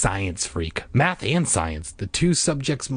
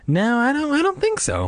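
high nervous refusal. A narrator's reading.

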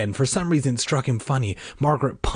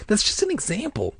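high, exasperated explanation; irritated sister's protest; impatient; 11 semitones higher than usual. A narrator's reading. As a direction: explain as if fed up, pitched up. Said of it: "Margaret said impatiently".

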